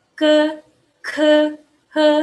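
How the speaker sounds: "ke, ke, he" high and level; sustained high syllables for repeating; consonant drill. Shown as a demonstration. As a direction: hold each syllable evenly, with gaps. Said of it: "kita baca bareng-bareng".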